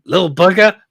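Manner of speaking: gruff Boba Fett voice. Retold or imitated. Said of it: "He loves saying".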